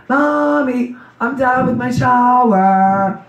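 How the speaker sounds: put-on high, very slow child's call; little daughter calling. Mother imitating her little daughter calling from the shower, high and slow. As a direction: sing it out like a small girl upstairs. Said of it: "she'd be like".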